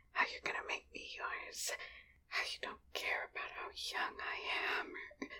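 urgent hushed whisper, barely voiced; secret whisper. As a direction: whisper so nobody else hears. Whispers, someone is listening.

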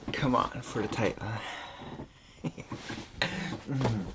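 usual speaking voice

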